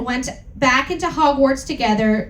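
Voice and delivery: normal talking voice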